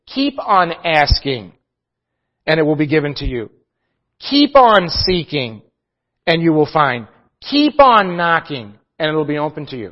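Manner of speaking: slow, with weight on each keep; drawn-out keep on. Shown as a demonstration. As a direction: lean on every keep, persistently. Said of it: "it should be translated like this".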